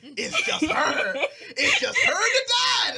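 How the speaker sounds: cracking up while saying it; laughing. Real, not acted. Laughing loudly to co-hosts about the plot twist.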